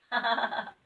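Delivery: laugh. Acted.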